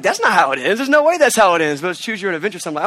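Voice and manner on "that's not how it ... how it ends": loud, high protest; a mock incredulous cry; disbelieving outburst. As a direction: refuse to believe the ending, out loud. Retelling reaction to book ending.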